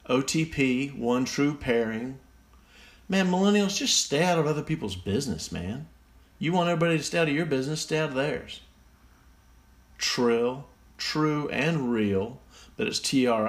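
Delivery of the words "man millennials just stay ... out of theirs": exasperated scolding. Real, not acted. Scolds millennials.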